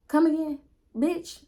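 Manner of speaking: usual speaking voice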